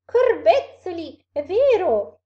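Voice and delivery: high, loud, stretched-out amazement; astonished exclamation. A narrator's reading. Snake sees the monster is real, exclaiming. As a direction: exclaim it loudly, drawing out the words. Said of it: "esclamò la biscia".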